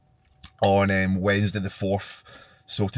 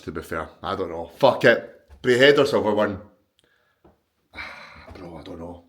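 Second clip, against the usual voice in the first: ordinary talk, nothing marked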